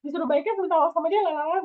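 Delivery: high, fast offended outburst; indignant protest. Retold or imitated. Friend told to make up, protesting high and fast. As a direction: protest being told to apologise. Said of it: "Ntar gimana".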